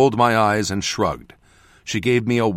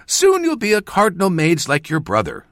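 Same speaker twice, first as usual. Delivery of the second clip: high, gushing mother's praise; 7 semitones higher, 3 dB louder than usual. A narrator's reading. Mother gushing over her son's future, high and eager. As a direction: pour out the pride. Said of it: "she gushed".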